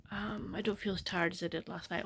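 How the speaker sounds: ordinary talk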